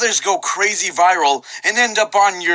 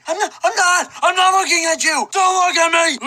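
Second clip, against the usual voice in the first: high screaming; 12 semitones higher than usual. Acted. Imagined dudes' shouting scene.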